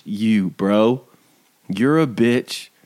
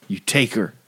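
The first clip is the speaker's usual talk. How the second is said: ordinary talk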